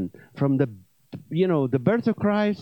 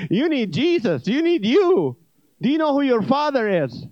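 higher and louder preaching at him; fervent witnessing; 8 semitones higher, 4 dB louder than usual. Retold or imitated. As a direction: push hard, like an eager evangelist. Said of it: "So I started witnessing to Jesus".

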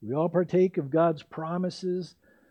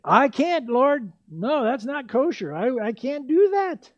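higher, louder pleading refusal; Peter refusing; 8 semitones higher, 5 dB louder than usual. Retold or imitated. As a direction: beg off high and loud, stammering. Peter refusing God, acted.

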